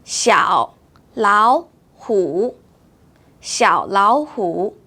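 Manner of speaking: 小 low half tone, 老 rising, 虎 dipping; low 小, then 老虎 slowly; tone sandhi. Shown as a demonstration. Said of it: "So this word would be spoken as".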